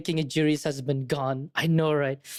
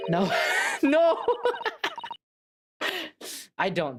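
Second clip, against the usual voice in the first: ordinary talk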